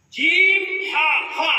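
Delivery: ordinary talk, nothing marked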